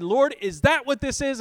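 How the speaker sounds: retold angry outburst, high and loud; mad at God. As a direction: challenge the Lord in loud indignation. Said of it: "I was in a mad moment".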